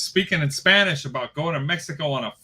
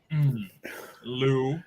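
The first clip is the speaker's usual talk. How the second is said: ordinary delivery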